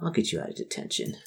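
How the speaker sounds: hushed conspiratorial whisper; janitor's whisper. Acted. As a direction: lean in and whisper the deal. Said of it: "he goes".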